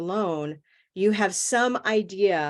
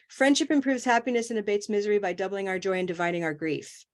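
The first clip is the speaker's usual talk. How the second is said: really fast, no pauses; faster than usual. Shown as a demonstration. Teacher showing students how not to read, really fast. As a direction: rush it all in one breathless run. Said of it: "That sounded really fast".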